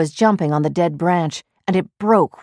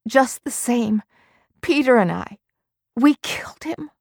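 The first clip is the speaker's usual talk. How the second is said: a hint of a higher, grieving woman's voice; mild anguished confession; lightly voiced anguished wife; 7 semitones higher than usual. A narrator's reading. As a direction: read her lines a bit higher, in guilt. Marion's voice, anguished.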